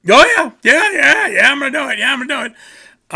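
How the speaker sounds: high, loud yeah yeah brush-off; high sing-song fake agreement; mock eager promise. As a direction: overeager yes-yes that means no.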